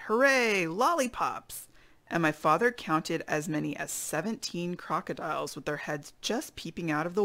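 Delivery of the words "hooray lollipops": stretched-out storybook hooray; a chorus cheer, higher than usual; shouted cheer. Acted. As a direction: cheer it, drawing out the last word. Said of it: "chorus of voices shouted".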